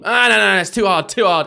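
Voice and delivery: high, loud whiny imitation of a co-host; mocking Dylan. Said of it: "He's so confident with it".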